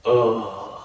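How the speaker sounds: low, long fed-up groan; a deep groan of disbelief; gigantic exasperated groan. Retold or imitated. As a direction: retell the scoutmaster's huge weary ugh. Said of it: "after a gigantic".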